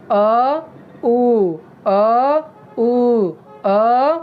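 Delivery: "अ" and "उ" said loosely, throat relaxed; lax vowel demo. Shown as a demonstration. Teacher showing students lax vowels, relaxed throat. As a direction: alternate "अ" and "उ" with slack throat muscles. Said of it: "मांसपेशियों पर इतना खिंचाव नहीं हो रहा है".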